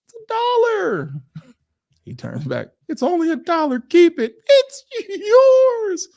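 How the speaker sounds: very high, sing-song politeness; mock a too-nice helper in falsetto; high sweet polite voice. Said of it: "So something like this".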